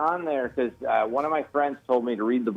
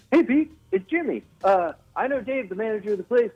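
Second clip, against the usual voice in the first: high-pitched, breathless talk-show host impression; eager greeting, pitched well up, a little out of breath; Jimmy Fallon; 7 semitones higher than usual. Host introducing himself to a comedian at the club, breathless impression. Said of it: "that is a great impression".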